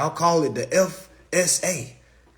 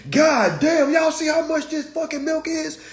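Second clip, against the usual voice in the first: high, loud mock yell about the milk price; mock outraged shopper; 11 semitones higher, 4 dB louder than usual. Imagined shopper yelling in the grocery store, mock outrage. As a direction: ham up an angry shopper yelling in the aisle. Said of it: "Nobody's in the grocery store saying".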